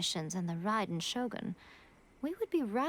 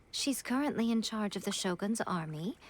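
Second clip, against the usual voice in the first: usual speaking voice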